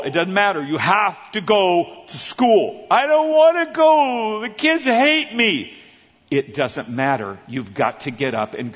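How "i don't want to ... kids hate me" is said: put-on high, slow whining child; whiny kid. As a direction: whine like a kid refusing school. Son whining to mom.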